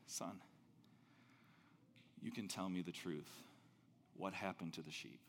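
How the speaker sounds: put-on low, soft, fading old-man voice; a weak whisper-quiet plea from the deathbed; dying father. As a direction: speak as a frail father on his deathbed. Father speaking from his deathbed.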